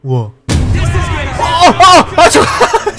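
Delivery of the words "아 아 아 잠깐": sudden high panicked yell; startled shout. Real, not acted. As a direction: cry out in alarm. Getting shot in game.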